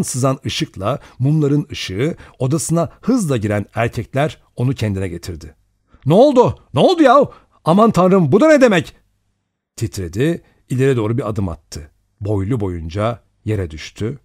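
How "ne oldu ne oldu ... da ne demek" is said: loud, high, startled cries; alarmed shouting. A narrator's reading. Men bursting into Vera's room, alarmed shouts. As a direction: call out in shock and alarm.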